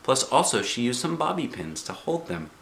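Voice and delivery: plain reading voice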